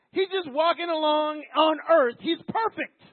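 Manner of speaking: high, loud, building to a cry; fervent preaching. Real, not acted. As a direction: pour passion into it.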